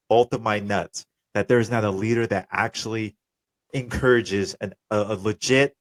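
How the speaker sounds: ordinary talk, nothing marked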